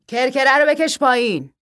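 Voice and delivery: loud, high command; shouted order. Acted. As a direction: call out to your son across the shop. Father barks an order to son.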